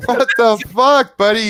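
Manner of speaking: high, loud, drawn-out hail; excited greeting. Acted. Lithium greets a friend he thought was dead, loudly excited. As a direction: boisterously greet a long-lost buddy.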